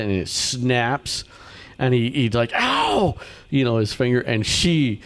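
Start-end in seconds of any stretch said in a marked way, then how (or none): 2.5-3.2 a sudden high yelp of fake pain; mock pained yelp. Teacher faking a snapped finger for the kids, high pained yelp. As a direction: yelp like the trap just caught your finger.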